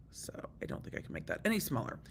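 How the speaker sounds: whispered aside to herself; whispering. Real, not acted. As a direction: mutter under your breath. Muttering to herself at screen.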